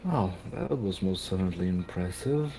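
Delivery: character voice. Acted. Character voice remark.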